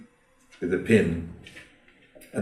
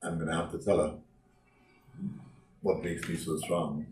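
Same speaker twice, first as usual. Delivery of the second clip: much softer, low inner resignation; to himself; 8 semitones lower, 13 dB quieter than usual. Acted. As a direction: murmur it like a defeated man deciding. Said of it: "he said to himself".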